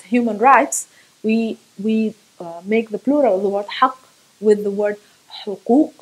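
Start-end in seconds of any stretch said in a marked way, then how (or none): none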